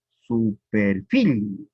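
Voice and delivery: "su perfil" very slow, per stressed; slow scansion demo. Shown as a demonstration. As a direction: drag out "su perfil" and hit per. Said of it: "está en la tercera sílaba".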